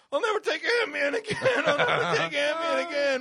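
high, loud, wailing panic; panicked vow. Retold or imitated. Man acting out swearing off Ambien mid-nightmare, panicked wail. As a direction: wail it as if fleeing for your life. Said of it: "And I'm going".

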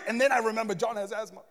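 usual speaking voice

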